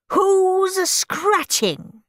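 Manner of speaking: high, slow stern demand; demanding teacher. A narrator's reading. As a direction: bark the question at the class. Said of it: "she demanded".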